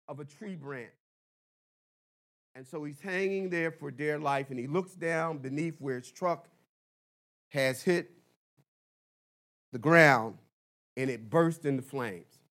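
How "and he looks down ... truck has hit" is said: ordinary talk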